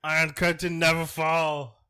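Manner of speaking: mock German accent. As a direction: play a cartoon German spy. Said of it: "that's a German accent".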